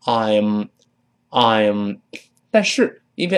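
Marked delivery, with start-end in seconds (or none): 0.0-2.0 very slow, full "I'm"; slow full form. Shown as a demonstration. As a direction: stretch "I'm" into a clear diphthong. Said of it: "理论上我们读成I'm". (慢读完整的I'm)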